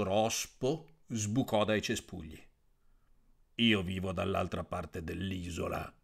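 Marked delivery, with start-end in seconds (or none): 3.6-5.9 toad voice. Acted.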